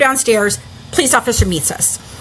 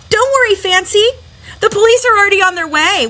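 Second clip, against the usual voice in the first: higher pitched mock shout across the room; Mike yelling; 8 semitones higher than usual. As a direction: call it out smugly, a retold yell. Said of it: "Mike yells out at me".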